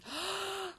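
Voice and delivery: long open-mouthed gasp; inspiración fuerte. Shown as a demonstration. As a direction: suck air in as if over menthol. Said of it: "ha hecho así". (aspirar aire por la boca)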